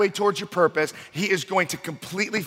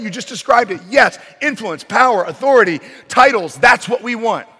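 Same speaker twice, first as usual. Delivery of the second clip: eager begging; 6 semitones higher, 7 dB louder than usual. Acted. Preacher voices eager disciples begging Jesus.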